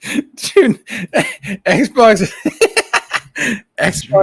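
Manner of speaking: high, loud words broken by real laughter; laughing. Real, not acted. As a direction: crack up while getting the words out. Cracks up while naming Xbox.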